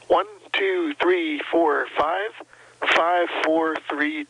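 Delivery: ordinary talk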